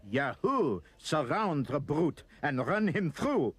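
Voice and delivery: loud, slow war cry of a knife-waving mob; mob scream. A narrator's reading. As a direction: scream it, charging in for the kill. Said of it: "They screamed".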